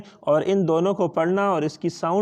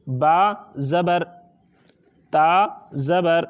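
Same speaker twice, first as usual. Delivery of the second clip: slow spelling out; much slower than usual. Shown as a demonstration. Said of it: "جب اس طرح ہم پڑھتے ہیں".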